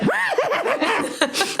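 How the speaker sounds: giddy high-pitched laughter; high delighted laugh. Real, not acted. Being petted like a pony, giddy high laugh.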